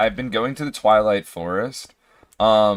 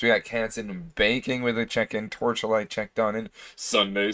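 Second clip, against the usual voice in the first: ordinary delivery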